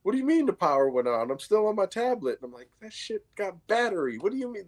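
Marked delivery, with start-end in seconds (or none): 0.0-2.4 higher-pitched kid protest, imitated; puzzled daughter. Daughter on her tablet doubts the outage, puzzled. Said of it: "So she's like". 2.8-4.7 high-pitched, incredulous retort, retold; exasperated dad. Dad explains the tablet to daughter, exasperated.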